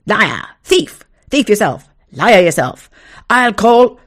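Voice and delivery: loud, high trading of insults; shouted insults. Acted. Two men trading insults across the yard, shouting. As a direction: spit liar and thief back.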